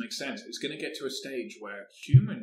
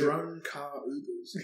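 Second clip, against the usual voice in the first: slow dramatic announcement; 3 semitones higher, much slower than usual. Pretend. Joking pronouncement, slow.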